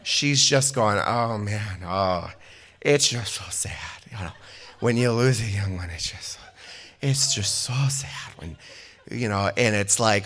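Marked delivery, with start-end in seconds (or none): 1.0-9.5 imitated maudlin town lush; mock tipsy lament, trailing into breathy fragments; drunken eulogy. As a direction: play a drunk mourner losing the thread. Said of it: "drunkenly clung to the podium".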